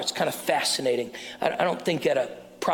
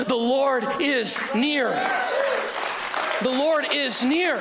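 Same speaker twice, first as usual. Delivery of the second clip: preaching at full force; 9 semitones higher than usual. Acted. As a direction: proclaim it slowly to the crowd, rising. Sermon climax preaching.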